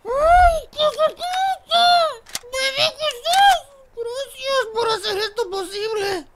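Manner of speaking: very high, loud, slow falsetto wail; overjoyed mock-crying shrieks of gratitude; llanto de alegría fingido. Mock tearful thanks to Baby Jesus. (chillido agudo de emoción exagerada)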